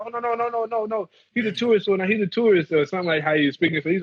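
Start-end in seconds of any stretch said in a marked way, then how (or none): 0.0-2.7 urgent explaining. Retold or imitated. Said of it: "the other guy said".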